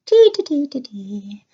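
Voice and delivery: a hint of a playful Irish accent, high chirpy "tee". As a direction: sing out "tee, tee" in a light Irish lilt. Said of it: "my Irish voice".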